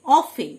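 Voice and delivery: "অফেন" with F and no T; silent T. Shown as a demonstration. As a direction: model "অফেন", leaving out the T after F. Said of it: "টি silent". ("অফেন", টি উচ্চারণ না করে)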